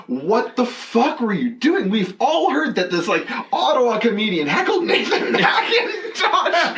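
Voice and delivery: high, drawn-out mock outrage; scandalized comedian, acted out with a laugh; imitated disbelief. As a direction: confront them over the gossip, breaking into laughter. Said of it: "he's like".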